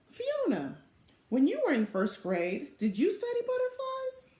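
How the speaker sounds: put-on high, bright child's question; little sister asking her middle sister; young Velma asking. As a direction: ask big sister eagerly in a little girl's voice. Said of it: "Velma asked her middle sister".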